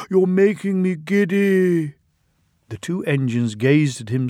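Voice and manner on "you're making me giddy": high, pleading diesel; begging. Acted. The dizzy diesel pleading with the twins. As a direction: beg him to stop. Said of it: "he begged".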